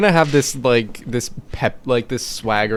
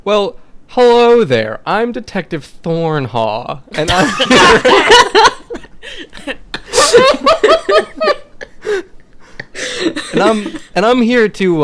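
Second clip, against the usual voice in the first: high, loud, slow showman greeting, breaking into laughter; cocky detective laughing through his own act; over-the-top detective; 12 semitones higher, 7 dB louder than usual. Acted. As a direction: ham up a smooth detective intro. Detective putting on charm.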